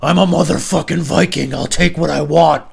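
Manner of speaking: imitating a drunk friend roaring he's a Viking; Viking boast. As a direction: bellow it like a drunk warlord. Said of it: "and he's like".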